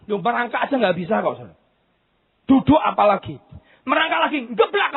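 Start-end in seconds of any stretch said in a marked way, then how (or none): none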